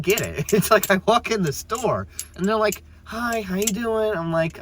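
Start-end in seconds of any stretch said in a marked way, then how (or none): 3.0-4.1 high, slow syrupy greeting, hammed up; mock sweet clerk. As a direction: mimic a fake-friendly cashier. Clerk greeting mocked in rant.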